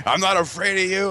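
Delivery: high-pitched mock-defiant challenge; defiant stepmom. Retold stepmom's defiant return.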